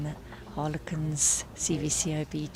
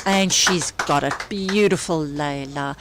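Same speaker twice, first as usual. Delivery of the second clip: loud, thrilled call of the shot; excited commentary; 10 dB louder than usual. Real, not acted. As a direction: burst out cheering the shot. Shot lands, commentator erupts.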